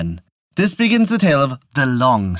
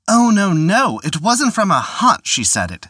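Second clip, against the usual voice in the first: higher, louder hurried protest; protesting taxman; 8 semitones higher, 3 dB louder than usual. Acted. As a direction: protest in a panic. Collector protests in alarm.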